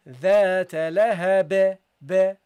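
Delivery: slow, loud "ذات لهب" with a bouncing final b, then "ba" alone; bounced final ba. Shown as a demonstration. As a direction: recite "ذات لهب" and echo the released "ba". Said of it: "You give it a bounce".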